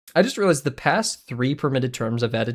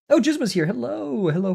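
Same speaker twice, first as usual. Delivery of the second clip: usual speaking voice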